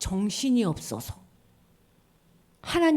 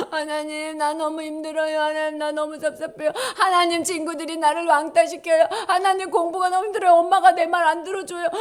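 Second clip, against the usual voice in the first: crying prayer; 5 semitones higher, 5 dB louder than usual. Acted. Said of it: "울어야 돼".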